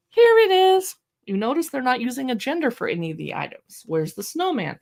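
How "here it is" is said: much higher, louder joyful reveal; excited reveal. Shown as a demonstration. Showing the baby the picture, loud joyful reveal. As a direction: burst out with the answer for a baby. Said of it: "read it with an enthusiastic tone of voice".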